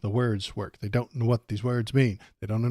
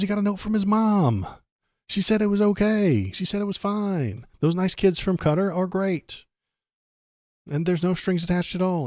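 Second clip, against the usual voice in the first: sarcastic; 7 semitones higher than usual. Retold or imitated. Sarcastic mock of excuses.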